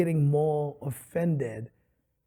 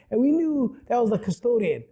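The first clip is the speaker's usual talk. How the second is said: ordinary talk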